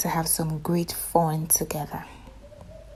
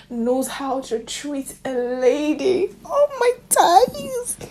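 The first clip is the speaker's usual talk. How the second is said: high, slow, crying-out "oh my days"; a whimpering fangirl wail of delight; swooning squeal; 9 semitones higher, 4 dB louder, much slower than usual. Real, not acted. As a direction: melt at the romance. Swooning over romantic moment.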